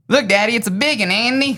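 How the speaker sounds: put-on high, loud little-kid Southern twang; thick Southern accent. Shown as a demonstration. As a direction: imitate your younger self's thick Southern accent, calling out to Daddy. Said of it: "ridiculously thick Southern accent".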